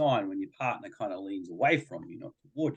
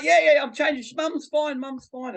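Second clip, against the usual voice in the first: laughing reassurance; 4 dB louder than usual. Retold or imitated. Dad laughing mock reassurance.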